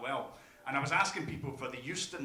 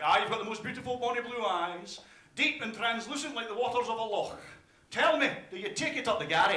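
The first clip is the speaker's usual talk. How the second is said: floaty, higher-pitched Scottish wooing; romantic Scottish lilt; 6 semitones higher, 3 dB louder than usual. Acted. Scot chatting someone up, mock-romantic lilting Scottish voice. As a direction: croon it melodically, with a hint of menace. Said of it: "floaty and full of melody with a hint of murder".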